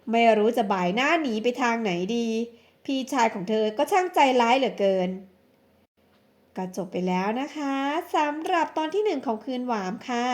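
plain reading voice

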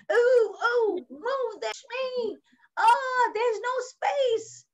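high, mock squeezed whining; squashed complaint. Animals jostle in the packed mitten, high mock complaint. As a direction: complain as if being shoved.

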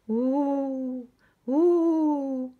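high rising ghostly wail; ghostly hoot. A narrator's reading. Spooky noises outside the tent at night, ghostly hooting. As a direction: moan like a ghost.

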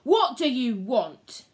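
snarled. Acted. Macbeth demanding of the witches, snarling. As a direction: snarl to hide the fear. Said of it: "he snarled to conceal it".

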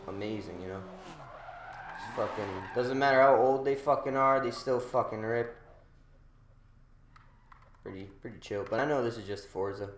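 usual speaking voice

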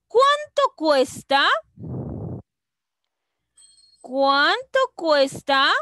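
CUÁNTO agudo y fuerte, CUESTA subiendo; pregunta. Shown as a demonstration. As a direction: pregunta enérgica con final levantado en «cuesta». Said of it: "Estoy preguntando". (pregunta marcada, aguda y fuerte)

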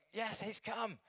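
high imitated cry of relief and welcome; eager crowd. Crowd greets the promised messianic figure, eagerly. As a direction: pitch it right up like an excited follower.

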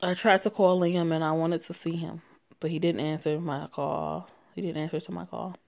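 ordinary delivery, nothing marked

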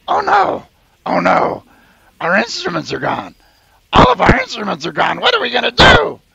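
high, loud frantic squeal; frantic little band creature; panicked high creature. Acted. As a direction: squeak out the alarm about stolen instruments. GM voices Royo's panic.

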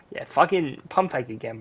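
normal talking voice

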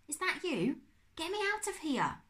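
high-pitched, pressing call for help; urgent plea. A narrator's reading. Trapped Uncle Max calls up to Darkus, urgent plea. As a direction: call out to be freed.

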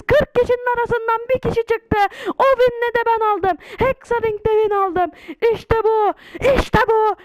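high, loud, ecstatic yelling; real overjoyed victory shout; victory screaming. Real, not acted. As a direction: scream the win at the top of your lungs. Winning the whole game.

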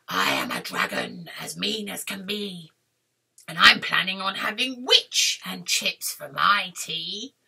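low dragon character voice; menacing dragon. Acted. Dragon plans to eat witch, menacing voice. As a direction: threaten low like a hungry dragon.

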